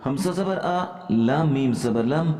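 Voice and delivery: usual speaking voice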